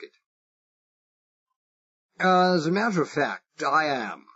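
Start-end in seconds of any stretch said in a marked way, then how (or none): 2.2-4.3 put-on high-class English accent laid on thick; plummy upper-class English, a bit higher; exaggerated English accent. Spy bluffing the Irish foreman, exaggerated posh English accent. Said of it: "Far from trying to conceal my British accent, I exaggerated it".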